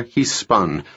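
plain reading voice

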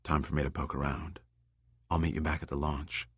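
low, hushed confidential murmur; whispered aside. A narrator's reading. Austin slipping away, tells Kayla privately, in a soft whispered aside. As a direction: keep it soft, for one ear only. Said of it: "Austin whispered into Kayla's ear".

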